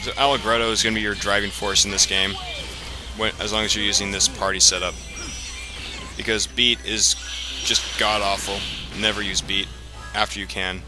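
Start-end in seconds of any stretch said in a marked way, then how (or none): none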